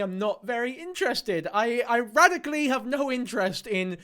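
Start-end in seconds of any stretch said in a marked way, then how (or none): none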